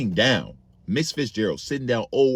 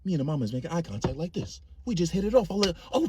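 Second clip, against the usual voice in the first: usual speaking voice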